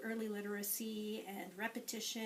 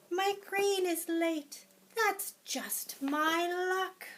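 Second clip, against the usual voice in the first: higher, louder grumbling hen; 8 semitones higher, 7 dB louder, much slower than usual. Acted. Teacher voicing the hen, higher and louder. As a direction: moan about bad luck as the hen.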